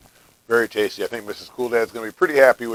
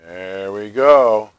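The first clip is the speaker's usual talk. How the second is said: talking through a bite; words round a mouthful; mouth full; 5 dB louder, much slower than usual. Real, not acted. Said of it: "I already took a bite".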